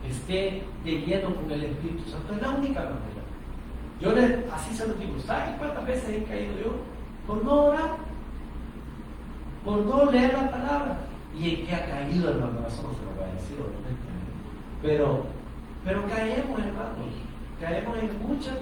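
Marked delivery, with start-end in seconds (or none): none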